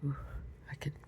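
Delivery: ordinary delivery, nothing marked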